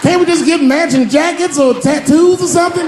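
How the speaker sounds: very high, loud shocked shout. Acted. Friend shouting back in disbelief, very high and loud. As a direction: yell it in disbelief.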